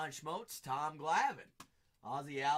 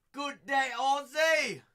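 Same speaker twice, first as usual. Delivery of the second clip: mock Aussie greeting; 9 semitones higher, 10 dB louder, slower than usual. Greeting viewer Ozzie mock Aussie.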